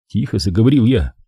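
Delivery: usual speaking voice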